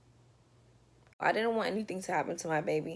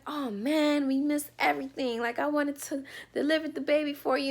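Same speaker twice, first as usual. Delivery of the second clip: lightly voiced, higher disappointed paramedic; a paramedic sorry he missed it; paramedic's voice; 7 semitones higher than usual. Paramedics telling the mother they missed the birth, mildly mock.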